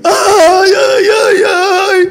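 slow, high, loud drawn-out wail; wailing cry of relief. Acted. Bread stays bread, relief.

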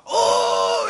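high, loud drawn-out wail; mimicked scream. Preacher imitates baby elephant left with hyenas, screaming. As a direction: wail out high like an abandoned animal. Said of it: "ופתאום התחיל לצרוח".

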